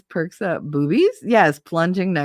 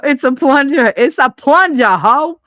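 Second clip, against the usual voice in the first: loud, high playful shout; loud joking yell; shouted joke; 9 semitones higher, 9 dB louder than usual. Acted. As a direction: belt the punchline out, laughing. Laughing shout of a joke phrase.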